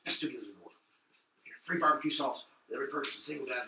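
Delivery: quiet, deadpan sponsor plug; fake ad read. Plugging a friend's album to listeners, quiet ad-read voice. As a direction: read the plugs like a low-key ad.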